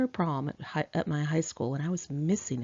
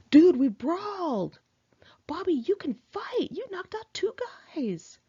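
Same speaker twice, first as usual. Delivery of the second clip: pumped-up, higher cry, slowing on "brawled", then a breathy excited rush; 13 semitones higher than usual. Retold or imitated. Michael praising Bobby after the brawl, pumped up and excited. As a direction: crow about the fight, buzzing with adrenaline. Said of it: "everybody was all pumped up, especially Michael".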